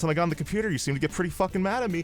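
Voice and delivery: high-pitched, needling retort; mock bickering. Green-haired coworker needles the advisor, mock bickering. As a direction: throw it back at him, pitch up. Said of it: "they start going at each other".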